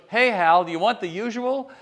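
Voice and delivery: calling out. Retold or imitated. Barista calling across the line.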